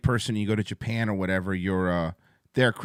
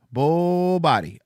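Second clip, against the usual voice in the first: "Bull body" said slowly and drawn out; slow repeat; 4 semitones higher, much slower than usual. Shown as a demonstration.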